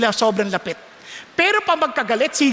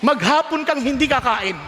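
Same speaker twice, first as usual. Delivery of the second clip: ordinary delivery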